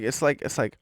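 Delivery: usual speaking voice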